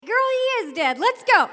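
high, emphatic no-nonsense retort; exasperated order. Retold or imitated. Mister Jones hauling the screaming girl out, exasperated. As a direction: shut it down and march the child out.

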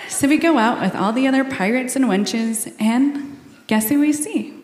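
ordinary delivery

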